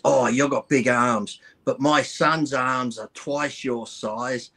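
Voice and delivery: slow, drawn-out bragging; lightly voiced proud mother; slow boastful lady. Lady bragging about her son.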